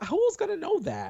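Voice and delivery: high incredulous outburst; exasperated. Real, not acted. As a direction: throw up your hands, high. Exasperated rhetorical question.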